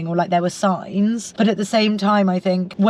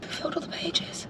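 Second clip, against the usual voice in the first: whispering; 8 semitones higher, 13 dB quieter than usual. Real, not acted. Surprised aside between friends.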